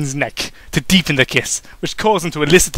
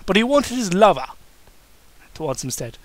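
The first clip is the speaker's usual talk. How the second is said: plain reading voice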